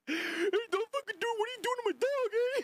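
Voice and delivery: high squealing impression; mock high yell. Streamer imitating the clip guy, high squeal.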